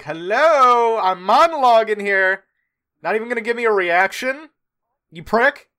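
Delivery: high, loud mocking taunt; Howland taunting a corpse. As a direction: jeer at him, loud and high. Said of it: "he's just like".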